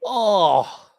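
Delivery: loud, high drawn-out "oh"; dismayed groan. Real, not acted. Lawyer reacting to a courtroom accent, dismayed groan.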